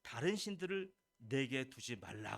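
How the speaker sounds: slow, weighty divine decree; 느리고 엄숙하게; slow solemn commandment. Acted. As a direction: pronounce it slowly like God's command. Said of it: "하나님이 그다음에 세 번째는".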